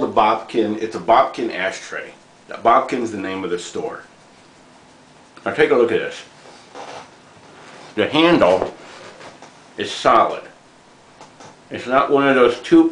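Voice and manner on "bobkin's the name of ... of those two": talking around clenched cigar; cigar in mouth. Real, not acted. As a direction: lips pinched on cigar. Seen 1: Cigar clenched in lips while talking; held in hand before and after.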